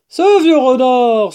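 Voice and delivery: slow, loud, high cry of recognition; big drawn-out exclamation; exclaiming. Acted. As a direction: hail an old friend loudly. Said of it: "s'écria Blaireau".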